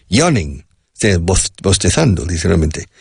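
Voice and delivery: ordinary talk, nothing marked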